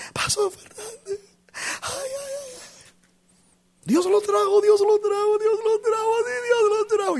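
high, wailing sobbing woman's voice; crying woman. Retold or imitated. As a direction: wail it out like a weeping mother. Sister Bermúdez crying, voiced. (mujer llorando a gritos con voz aguda)

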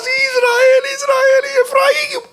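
high-pitched wailing cry in prayer; imitated tearful, keening intercession; reenacted weeping. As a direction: wail the names as if sobbing. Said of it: "pero yo lloraba".

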